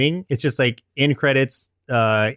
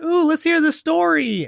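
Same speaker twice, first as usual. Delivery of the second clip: eager youngsters. Acted. Squirrel kids begging for the story, mock eager. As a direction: pitch it way up like delighted little ones.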